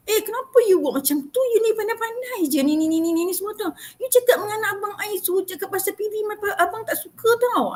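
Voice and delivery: high, nagging complaint voice; high scolding mimicry; mocking an upset customer. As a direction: whine it high like a scolding relative. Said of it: "Because this person kata".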